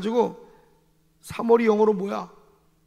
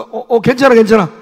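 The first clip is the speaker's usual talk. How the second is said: hearty reassurance; 11 dB louder than usual. Retold or imitated. Pastor now forgiving loudly.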